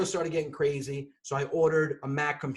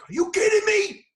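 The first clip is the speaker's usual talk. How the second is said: high, loud outraged shout; retold yelling; 8 dB louder than usual. Man yelling alone in the basement at his new computer, outraged yell. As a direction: yell it in disbelief. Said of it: "my wife starts hearing yelling".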